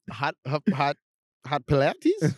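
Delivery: halting, repeatedly restarted reading; haltingly sounding out a baffling word; mock confusion. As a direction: puzzle over "Pilates" as if it won't parse. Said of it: "being just so confused".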